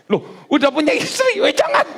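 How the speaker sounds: high-pitched playful protest with a laugh; mock astonished refusal. God turns down a married man asking to marry, mock astonished. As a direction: jump up high in comic disbelief.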